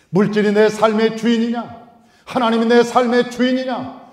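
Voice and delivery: loud, high sermon build; 힘주어 크게 외치는 설교; preaching at full force. Acted. Preaching climax. (높고 큰 소리로 외치는 설교)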